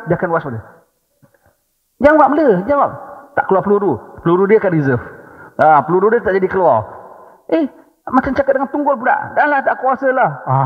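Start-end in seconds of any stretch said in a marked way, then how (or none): 2.0-2.9 high, loud demand to answer; nagging wife. Retold or imitated. Wife insisting her silent husband answer, high and sharp. 7.5-10.3 high, fed-up sigh of giving up; exasperated wife. Retold or imitated. Wife giving up on a husband who won't speak, high and weary.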